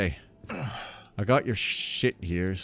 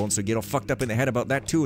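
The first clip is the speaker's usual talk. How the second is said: usual speaking voice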